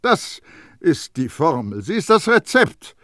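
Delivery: high, loud schoolmasterly perfumer; Baldini lecturing. A narrator's reading. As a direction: explain it like to a dunce. Baldini explains the formula.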